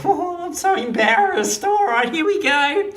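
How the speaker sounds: put-on high, flustered girlish voice; embarrassed bride. Kaylee gets up to say her vows, high embarrassed bride. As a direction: play a bride nervous before her speech. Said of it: "Kaylee gets up there".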